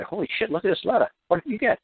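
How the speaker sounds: high, flustered chatter of stunned clerks; stunned agents reading an unexpectedly kind letter, high and flustered; baffled office workers. Retold or imitated. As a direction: play the confused agents passing the letter round. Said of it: "pass the letter around and say".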